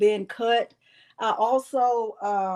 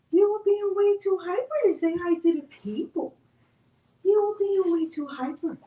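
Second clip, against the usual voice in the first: put-on baby talk to dog; 8 semitones higher than usual. Talking to dog.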